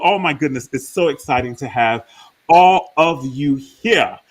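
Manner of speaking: normal talking voice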